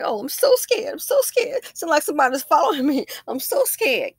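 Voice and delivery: very high, loud whimpering fake fright; imitating the scared old lady at the door; hammed-up terror. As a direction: mimic her frightened act in a squeaky voice. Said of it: "she's like".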